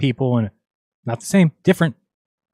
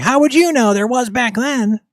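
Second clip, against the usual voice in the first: squeaky, much higher mocking believer voice; 9 semitones higher, 4 dB louder than usual. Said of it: "It's like".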